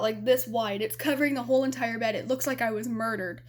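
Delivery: usual speaking voice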